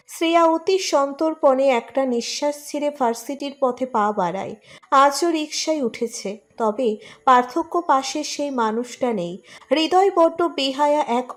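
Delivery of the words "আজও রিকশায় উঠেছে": usual speaking voice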